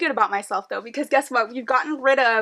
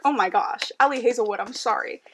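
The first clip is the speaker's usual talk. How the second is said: ordinary talk, nothing marked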